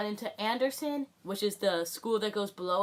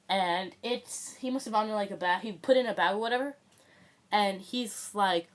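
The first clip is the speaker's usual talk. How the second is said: ordinary talk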